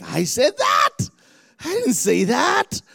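mock astonishment at your own words; incredulous. Preacher hearing his own recorded sermon, incredulous. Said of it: "I'm like".

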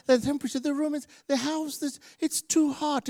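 high, quick, soft whine; hammed-up fussy complaint in a high voice; complainer. Churchgoer fussing about the room's heat, mock whiny. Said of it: "you do have people come".

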